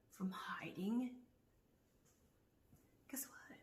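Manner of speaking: slow unvoiced whisper. Real, not acted. Whispering taunts at the hiding neighbors. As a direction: hush it out as a taunt.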